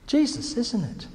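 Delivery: normal talking voice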